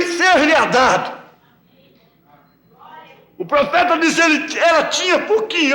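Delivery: lifted pulpit delivery; preaching. Acted. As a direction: preach it up to the congregation. Rising sermon on oil, flour.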